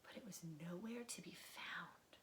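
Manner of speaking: whispered worry; spooky whisper. A narrator's reading. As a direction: hush it as if the dark could hear. Bear is lost in dark.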